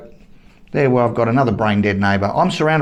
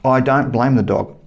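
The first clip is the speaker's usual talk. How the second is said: usual speaking voice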